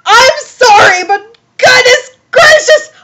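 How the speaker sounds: high, loud wail of disbelief; overwhelmed outcry. Real, not acted. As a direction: cry out, scandalised and shrill with shock. Overwhelmed by the plot twist.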